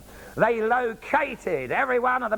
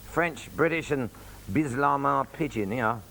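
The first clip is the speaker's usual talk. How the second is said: usual speaking voice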